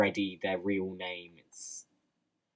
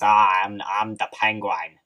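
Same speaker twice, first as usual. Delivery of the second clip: Arkham Penguin impression; 9 dB louder than usual. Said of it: "going the Arkham City route".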